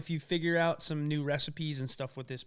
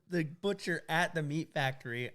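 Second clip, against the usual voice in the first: ordinary delivery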